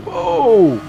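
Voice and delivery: loud, drawn-out "whoa"; startled whoa. Real, not acted. Driver startled on the track, surprised cry. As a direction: cry out in surprise, falling away.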